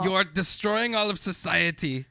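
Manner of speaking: loud, high, slow scolding voice; bossy inner rule follower. Pretend. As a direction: lecture like a strict enforcer. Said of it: "The Hitler inside".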